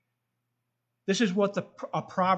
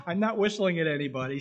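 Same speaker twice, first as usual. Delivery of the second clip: real suppressed laughter breaking through; trying not to laugh. Real, not acted. Preacher amused by their own whistling tooth, trying not to laugh. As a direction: talk through a laugh you're holding back. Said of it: "I'm trying not to laugh".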